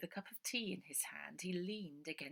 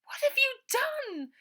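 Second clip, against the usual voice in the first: high, loud, slow anguished cry; horrified cry; 15 dB louder, slower than usual. A narrator's reading. Ida to Uncle Arthur, high horrified cry. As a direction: cry out in horror.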